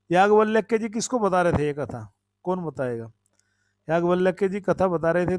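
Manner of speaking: ordinary talk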